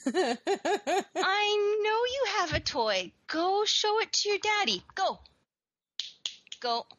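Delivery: high, drawn-out cooing order; pet talk. Real, not acted. Owner sending the cat off to daddy, high pet talk. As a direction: coax and shoo the cat in a high voice.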